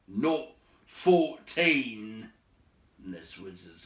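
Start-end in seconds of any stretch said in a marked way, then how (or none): 0.1-2.3 slow, loud Not fourteen with fourteen drawn out; slow emphatic Not fourteen; stressed correction. Acted. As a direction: lean hard on fourteen. Baron disputes the number.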